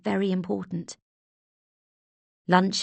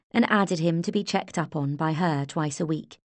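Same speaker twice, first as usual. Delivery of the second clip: ordinary delivery, nothing marked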